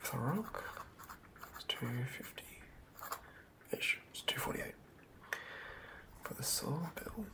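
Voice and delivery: normal talking voice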